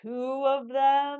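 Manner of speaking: loud, slow, shocked disbelief. Real, not acted. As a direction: gasp it out in disbelief. Shocked reaction to card lock.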